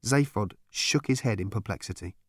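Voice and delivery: ordinary delivery, nothing marked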